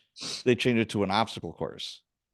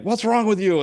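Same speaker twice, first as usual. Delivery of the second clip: higher, louder impatient demand; brusque instructor; 11 semitones higher, 6 dB louder than usual. Retold or imitated. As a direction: snap at a fallen recruit. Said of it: "she's like".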